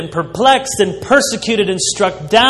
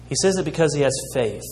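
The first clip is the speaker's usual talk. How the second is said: normal talking voice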